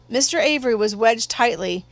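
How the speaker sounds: ordinary delivery, nothing marked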